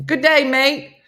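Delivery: high, loud, slow Australian greeting; attempted Australian accent. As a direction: belt out a stretched mock-Australian accent. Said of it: "how to sound Australian".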